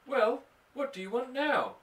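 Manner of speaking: high, impatient cat voice. Acted. Mother voicing the cat's impatient question, high. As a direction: snap it like a bothered cat.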